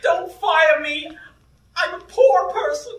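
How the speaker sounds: high, slow begging; squeaky melodramatic plea; mock pleading. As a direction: plead for your job in a hammed-up high voice. Acted employee pleading for job.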